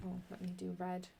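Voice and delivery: ordinary talk, nothing marked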